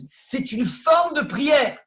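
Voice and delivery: slow, high and loud proclamation; preacher shouting the key lesson; emphatic shout. Real, not acted. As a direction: cry it out as the punchline. Preacher's climactic point.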